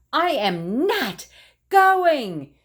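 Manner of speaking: slow building to a high, loud shout. Acted. Scene: Froggy shouts at Mom he refuses to go, slow building shout. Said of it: "shouted Froggy".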